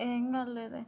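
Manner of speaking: softly, holding back tears; quiet tearful denial. Acted. Wife to husband asking why she cries, quiet tearful denial. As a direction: hide the crying, barely voiced. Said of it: "మరి ఎందుకు ఎడుతున్నావు?".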